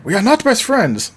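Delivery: high, emphatic protest; hammed-up objection, pitch way up; mock indignant denial. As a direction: act out a flustered rejection. Acted bickering between characters.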